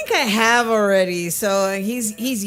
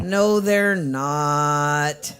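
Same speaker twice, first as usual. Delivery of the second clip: very slow, lower-pitched contradiction; drawn-out denial; 4 semitones lower, much slower than usual. Real, not acted. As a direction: stretch out each word to shut down the tease.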